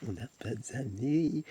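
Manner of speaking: slow, stretched-out whine over "d'amis"; slow playful wail; drawn-out mock lament. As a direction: drag every word out, lamenting.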